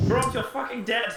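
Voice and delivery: high shouted mock dismay; mock outcry. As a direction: yell it in fake shock. Players mourn fallen party member.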